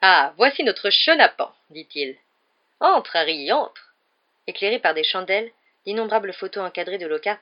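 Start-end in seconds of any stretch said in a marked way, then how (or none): 0.0-1.5 loud, slow and beaming; beaming greeting. A narrator's reading. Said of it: "sourire rayonnant". 2.8-3.9 showy host voice. A narrator's reading. Lockhart voiced, effusive.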